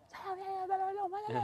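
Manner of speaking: high-pitched imitation of the caller. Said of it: "هو قال".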